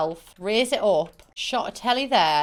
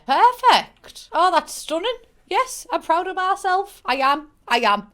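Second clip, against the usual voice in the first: excited; 9 semitones higher, 4 dB louder than usual. Real, not acted. Delighted at finished build.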